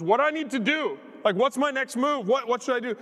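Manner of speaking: high, eager imitation of a seatmate; urgent pleading. Preacher voices woman asking her next move, urgent. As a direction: press the questions with acted urgency.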